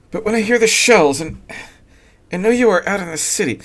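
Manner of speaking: the wife confesses her fear of the shells; young wife Sylvia. A narrator's reading. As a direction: shaken by the shells. Sylvia's voice held.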